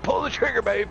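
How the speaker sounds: high-pitched egging on; excited urging. Real, not acted. Urging Sim to claim the ship, real excitement. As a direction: push them to go for it.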